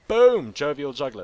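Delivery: loud high burst of triumph; triumphant exclamation. Real, not acted. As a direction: shout it like you found treasure. Finds the inn.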